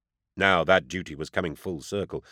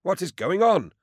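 fearful; 12 semitones higher, 3 dB louder than usual. A narrator's reading. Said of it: "a look of fear on his lined face".

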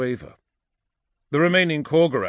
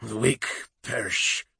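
hissed. A narrator's reading. Vul dismisses the weak, hissing. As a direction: hiss it through the teeth. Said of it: "hissed Vul".

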